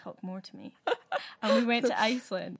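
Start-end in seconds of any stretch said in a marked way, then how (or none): 0.0-0.7 conspiratorial reply. Retold or imitated. Flatmate agrees to the prank.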